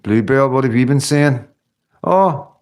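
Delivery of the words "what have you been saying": ordinary delivery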